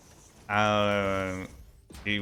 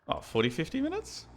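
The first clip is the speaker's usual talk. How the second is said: surprised; 4 dB quieter than usual. Real, not acted. Surprised at elapsed time.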